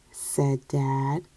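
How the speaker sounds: ordinary delivery, nothing marked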